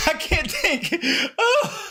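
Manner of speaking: laughing through the words. Real, not acted. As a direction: giggle it out, flustered. Laughing at own slip.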